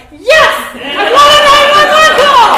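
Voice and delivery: very high, very loud desperate yell; eager yell. Retold or imitated. Woman to the officer on the phone, frantic yell. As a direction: shout it like begging for rescue.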